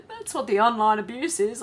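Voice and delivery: sarcastic laugh into the punchline; scoffing laugh. Real, not acted. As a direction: laugh it off, mocking. Mocking the PM's claim.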